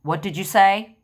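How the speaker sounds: retelling not hearing a friend, loud; mock loud asking. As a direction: call out what did you say. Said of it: "I literally walk up to her and I go".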